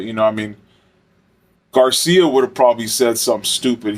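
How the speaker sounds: ordinary talk, nothing marked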